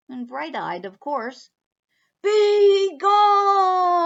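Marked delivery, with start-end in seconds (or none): 2.2-4.0 much higher, loud roar; roaring bear. Acted. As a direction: roar him out of the house. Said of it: "roared the bear".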